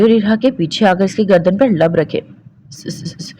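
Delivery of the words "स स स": shaky unvoiced stammer; a frightened quivering stutter; trembling gasps. A narrator's reading. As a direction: tremble through each sound. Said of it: "Irha ने कांप कर कहा".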